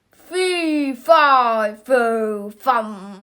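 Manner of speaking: loud, strange giant roar; booming giant. Acted. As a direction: bellow it like a giant from the sky. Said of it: "It shouted loud".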